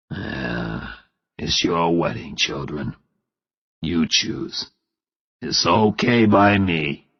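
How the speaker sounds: brave father. Acted. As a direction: swallow disappointment and give in bravely. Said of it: "But he spoke bravely".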